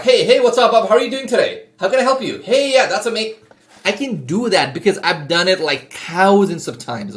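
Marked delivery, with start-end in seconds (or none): 0.0-3.4 high, upbeat phone greeting; energetic sales voice. Shown as a demonstration. Salesman greeting Bob on a sales call, high-energy. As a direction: turn on big energy for the client. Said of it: "I can create that energy with my voice".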